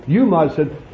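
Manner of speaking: ordinary talk, nothing marked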